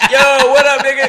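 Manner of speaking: very loud, high hammed-up greeting; mock robot switching to slang, laughing; reconfigured robot slang. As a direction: burst out in a put-on street voice. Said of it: "And then it's like reconfiguring".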